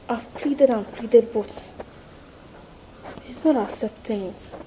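ordinary talk, nothing marked

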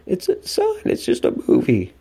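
squeaky high start, then dropping to a breathy hush; imitating Mom soothing her son; mimicking mom. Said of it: "Mom, I remember".

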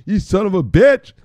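high, loud mock curse; bursting with glee; delighted shout. Real, not acted. Streamer spotting a funny follower name, shouting with glee.

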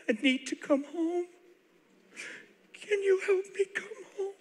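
high tearful plea, voice thin with crying; acted tearful, high-pitched begging; trembling plea. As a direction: plead tearfully to come home. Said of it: "He's trembling".

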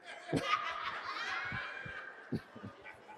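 high airy burst of laughter; breathy laugh. Real, not acted. Preacher laughing at her own story, high airy laugh. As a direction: laugh at the memory.

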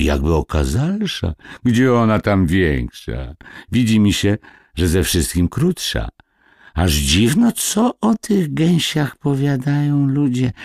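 high, marvelling gossips; old neighbours puzzling aloud; gossiping village women. A narrator's reading. Village gossips arguing over geese.

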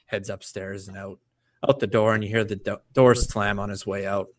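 ordinary talk

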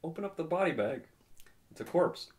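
high-pitched mock movie-line delivery; film character quote. Reenacting a favorite movie scene for friends, high-pitched character quote.

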